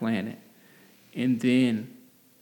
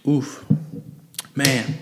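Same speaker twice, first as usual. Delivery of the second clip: while sipping a drink; 3 semitones higher than usual. Real, not acted. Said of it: "Let me take a sip real quick".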